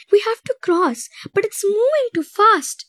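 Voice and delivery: high, panicked cry; panicked scream. A narrator's reading. Mariana at the raging river, screaming in panic. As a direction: shriek it over the roaring river. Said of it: "she screamed".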